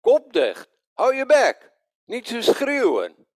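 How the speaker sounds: loud, high, acted shut-up; imitated angry shout; angry telling-off. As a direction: shout him down like an irritated crowd. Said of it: "En wat zeiden ze?".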